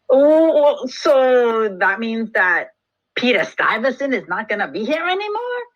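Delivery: hammed-up hopeful question; hopeful Dutch colonists. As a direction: play dawning hope with a big loud "Oh". Dutch hopeful reply in acted dialogue.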